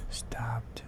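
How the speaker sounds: hushed suspense, barely voiced; a spooky storyteller's whisper. A narrator's reading. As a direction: breathe the word out in a whisper. Narrator hushes suspense word.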